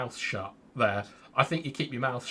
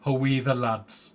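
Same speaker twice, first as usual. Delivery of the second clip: slow, drawn-out Geordie imitation; attempted Geordie accent; 4 dB louder than usual. Co-host trying it on request, attempted Geordie accent. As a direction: try a Geordie accent, taking it slowly. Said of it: "Can you try that for halftime?".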